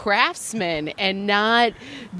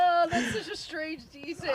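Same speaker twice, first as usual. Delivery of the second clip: laughing through it; 9 semitones higher, 9 dB quieter than usual. Real, not acted.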